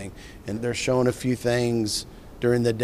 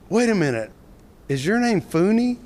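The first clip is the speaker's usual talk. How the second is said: rising, louder, amazed question; retold surprise; 10 semitones higher, 5 dB louder than usual. Scott realizes the stranger is Fooney, amazed and higher. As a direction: ask it like it just clicked.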